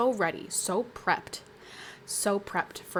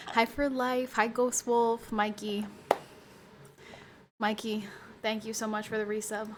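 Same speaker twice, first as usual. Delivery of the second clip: ordinary delivery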